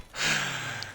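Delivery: real burst of laughter; short spontaneous laugh. Real, not acted. Laughs at own chat reference.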